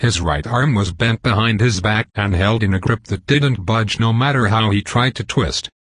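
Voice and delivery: ordinary delivery, nothing marked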